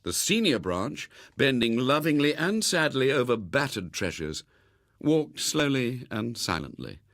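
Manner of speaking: ordinary delivery, nothing marked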